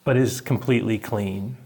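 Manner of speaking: usual speaking voice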